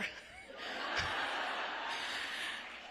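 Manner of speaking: breathy laugh. Real, not acted. Laughs after the story.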